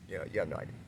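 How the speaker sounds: normal talking voice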